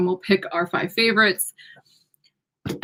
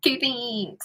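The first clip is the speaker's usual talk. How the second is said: thin reedy voice; 2 semitones higher, 8 dB quieter than usual. Retold or imitated. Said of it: "put on this weedy little voice".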